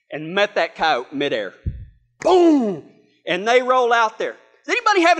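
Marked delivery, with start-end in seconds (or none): 2.2-2.8 loud, high boom; impact imitation. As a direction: hit it like a midair collision.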